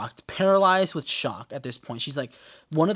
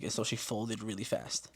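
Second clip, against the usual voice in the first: usual speaking voice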